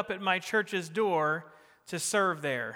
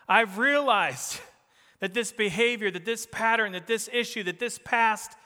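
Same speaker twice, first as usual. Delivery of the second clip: raised, louder, each item hit hard; building preaching; 5 semitones higher, 4 dB louder than usual. Acted. Preacher voicing the church's resolve, raised and forceful. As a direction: drive the list up like a call.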